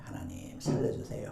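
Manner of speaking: breathy hushed plea to God; whispered prayer. Acted. Elisha whispering a prayer over the dead boy, hushed plea.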